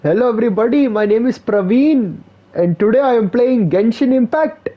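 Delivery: high, hammed-up Indian accent, gamer intro; mock Indian streamer saying hello. Mocking a Praveen streamer intro, high hammed-up Indian accent. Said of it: "every Indian can do the Indian accent".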